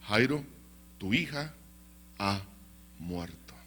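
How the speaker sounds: halting, sinking, hushed announcement; grave news. Acted. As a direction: break the death news in hesitant pieces. Messenger breaks news of a death. (noticia grave, entrecortada y apagada)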